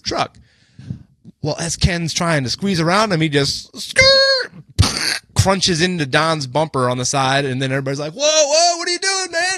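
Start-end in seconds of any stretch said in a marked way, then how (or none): 3.8-4.5 high, loud imitated metal screech; imitated bumper scrape, squealed high; screeching scrape noise. 8.1-9.5 high-pitched mock shout of alarm; alarmed protest. Acting out alarmed bystanders.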